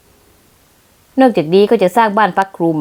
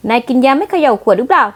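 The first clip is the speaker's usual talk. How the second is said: normal talking voice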